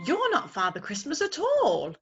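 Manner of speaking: crossly. Acted. Owlet cross at the young lady. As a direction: sulk that he was fooled. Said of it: "he said crossly".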